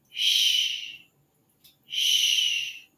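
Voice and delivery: sh held out long; sh, long steady hush. Shown as a demonstration. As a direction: sustain the sh. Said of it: "it's a longer sound".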